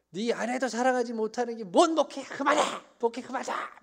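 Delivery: high, loud furious outburst; angry mother. Retold or imitated. Mother telling her son to quit ministry, furious high yell. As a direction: yell it as a fed-up mother laying down the law. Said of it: "막 화를 내시면서".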